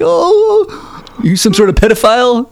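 very high mocking voice; new boyfriend confronting Ralph, in a high mock squeak; squeaky mock voice. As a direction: sneer it in a silly high squeak. Mom's new guy mocked.

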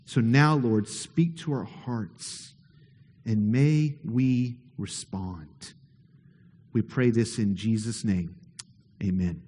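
low, slow, soft prayer voice; quiet pastoral prayer; hushed prayer. Acted. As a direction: pray it gently and slowly, eyes closed.

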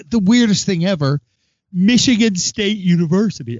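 excited announcer intro; show host intro. Acted. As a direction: hype up the guest. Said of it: "I can't do it like that".

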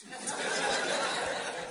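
breathy amused laugh; a quick unvoiced chuckle. Real, not acted. Preacher laughs at story.

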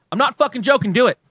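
loud, insistent demand; emphatic shout. Real, not acted. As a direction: shout it like you mean it. Insisting listeners subscribe.

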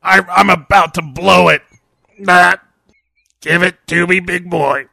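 loud, breathy lusty groans; hammed-up lusty moaning. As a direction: ham up a lusty climax. Acting mummy and Nolte sex.

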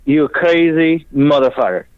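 mock the master's disbelief, drawn out; Dario impression. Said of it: "Dario looks at me and goes".

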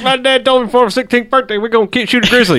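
over eager infomercial. Acted. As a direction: sell it like a TV salesman. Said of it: "launches into, like, infomercial guy".

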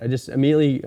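ordinary talk, nothing marked

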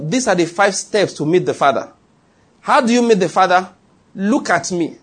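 ordinary talk, nothing marked

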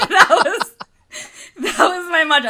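said through loud, high laughter; laughing. Real, not acted. Laughing at her own panic.

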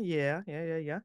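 soft, rushed yeah yeah; quietly agreeing out of nerves; meek mock yeses; nervous agreement. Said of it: "retold nervous agreeing".

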